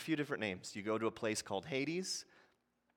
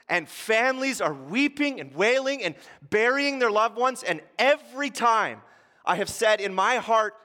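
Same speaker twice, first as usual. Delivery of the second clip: loud, higher preacher climax building to joy; thundering pulpit sermon, louder and higher; full-force preaching; 8 semitones higher, 9 dB louder than usual. Acted. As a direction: preach it with everything you've got.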